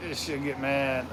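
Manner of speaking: soft, slow aside; quiet joke under the breath; whispered aside. Real, not acted. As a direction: hush it so the speaker won't hear. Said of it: "You gotta whisper that".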